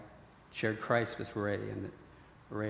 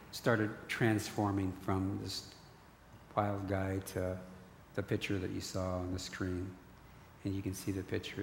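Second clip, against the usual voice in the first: normal talking voice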